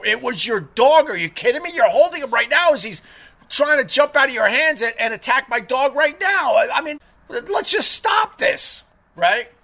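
high-pitched, incredulous arguing; retold indignation. Arguing with the owner holding his dog, retold indignation.

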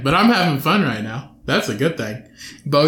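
ordinary delivery

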